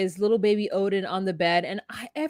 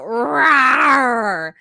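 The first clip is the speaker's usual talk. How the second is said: loud, higher playful roar; lion roar; 3 semitones higher, 8 dB louder than usual. Pretend. Joking that the lion got out, a mock roar. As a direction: roar like a lion let loose.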